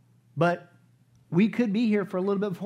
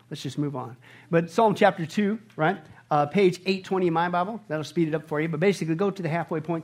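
usual speaking voice

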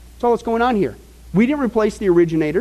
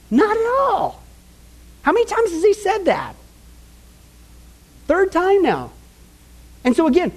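high, emphatic pulpit build; fired-up preaching; 10 semitones higher than usual. Acted. Preacher marvelling to the congregation at Paul's insistence, fired up.

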